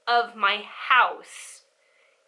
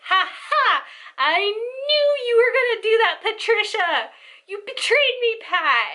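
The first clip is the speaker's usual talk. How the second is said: triumphant gotcha; 9 semitones higher than usual. Retold or imitated. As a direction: crow that you caught her out, hammed-up. Sister catching grandma out.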